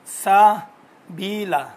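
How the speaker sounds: سلسبیلا drawn out very slowly; slow spelled reading. Shown as a demonstration. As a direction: read سلسبیلا slowly as written for the class. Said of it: "لکھا ہوا ہے". (سلسبیلا کو بہت آہستہ لکھے ہوئے کی طرح پڑھنا)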